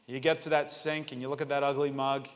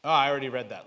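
ordinary talk, nothing marked

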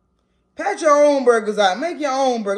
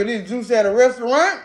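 eating mid-sentence; talking while chewing. Real, not acted. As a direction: mouth partly full. Seen 1: Bite taken at -2.0; talks holding burger, lips working, still chewing.

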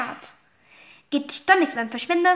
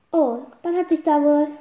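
normal talking voice

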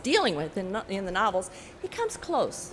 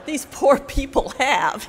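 laughing; 5 semitones higher, 7 dB louder than usual. Real, not acted.

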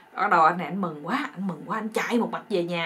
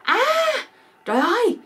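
high, loud mock shriek; hammed-up alarmed yell; shocked scream; 10 semitones higher, 8 dB louder than usual. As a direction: cry out as the horrified wife. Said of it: "cô la".

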